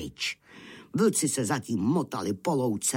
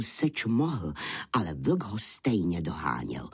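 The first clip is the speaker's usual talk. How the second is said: plain reading voice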